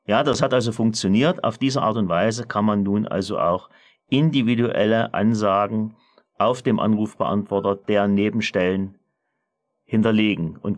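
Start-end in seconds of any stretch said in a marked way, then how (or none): none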